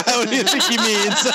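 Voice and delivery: laughing through the words. Real, not acted. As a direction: crack up on the repeated joke. Repeats the joke, laughing.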